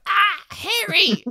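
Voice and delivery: very high, slow strangled yelp; choked comic cry. Retold or imitated. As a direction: squeal out while being choked. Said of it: "and he's just like".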